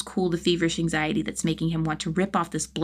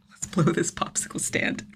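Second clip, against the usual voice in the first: unvoiced whispered repeat; 3 dB quieter than usual. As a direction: breathe it out softly.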